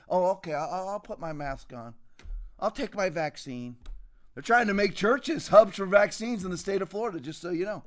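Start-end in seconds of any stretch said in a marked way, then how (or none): 0.1-3.8 soft, meek voice giving in; mock a timid churchgoer complying. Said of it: "gelding Christians".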